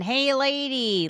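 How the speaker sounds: a slow, drawn-out Brooklyn "Hey, lady," called out high; high slow Brooklyn holler of "Hey, lady"; Brooklyn accent. Retold or imitated. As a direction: imitate a crusty Brooklyn plumber calling "Hey, lady" across the house. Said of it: "They have a Brooklyn accent".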